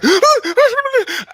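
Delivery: sharp high gasp of surprise; children react to the bang with a high gasp; high excited gasp. Retold or imitated.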